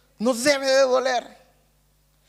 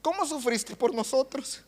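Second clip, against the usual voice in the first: tearful prayer; slower than usual. Retold or imitated. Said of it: "Yo empecé a llorar".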